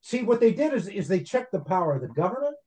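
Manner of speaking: normal talking voice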